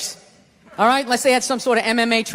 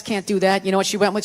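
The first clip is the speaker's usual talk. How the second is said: usual speaking voice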